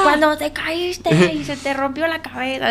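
put-on bratty kid teasing; toxic kid voice. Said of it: "Y todos los niños".

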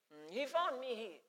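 put-on quiet singsong Nyanja accent. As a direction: sing the words in a Nyanja accent. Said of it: "He was singing, singing a Nyanja accent".

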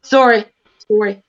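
"Sorry" with a rounded "SORE-ee"; mock Canadian "Sorry", vowel as in "sore". Shown as a demonstration. Repeating the Canadian way, "Sorry" said as "SORE-ee". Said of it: "It's not even sorry".

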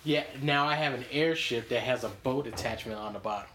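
ordinary delivery

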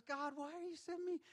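mock complaint. Complaining to God retold.

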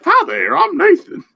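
squeaky high voice; silly high goofy voice; high cartoon voice. Joins mocking the hero.

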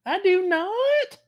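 high, slow, loud mocking drawl. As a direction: parody her twangy denial. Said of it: "Tanya says something in redneck".

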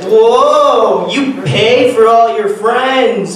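slow, high, eerie comedian voice; ghost voice. Retold or imitated. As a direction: drag it out like a haunting. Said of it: "like a ghost".

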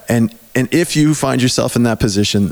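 ordinary talk

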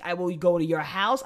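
ordinary delivery

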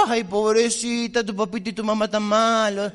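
hammed-up pity for a crying girl; mock pity. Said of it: "la gente está esperando que vos le digas".

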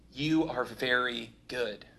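slow, gentle affirmation; tender blessing. Acted. God tells his creation it is very good, slow and tender. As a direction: linger over each word like a loving father. God's verdict over us.

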